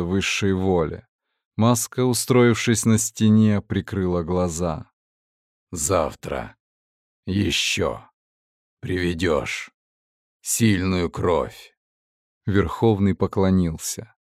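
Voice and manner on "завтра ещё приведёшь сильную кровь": low, soft, slow voice inside the head; the mask speaking slowly into his mind; whispery telepathic voice. Acted. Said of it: "Голос раздался в голове".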